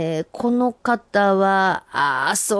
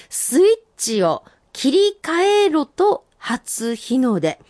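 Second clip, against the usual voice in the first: senryu recital; 5 semitones higher, slower than usual. Acted. Reading senryu aloud.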